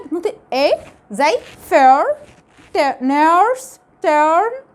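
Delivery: "fair", "nurse", "turn" stretched slowly with the er vowel; English word demo. Shown as a demonstration. Teacher gives example words to students, clearly modelled. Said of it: "لو بعديه آر قلنا دي ما فيهاش مشكلة".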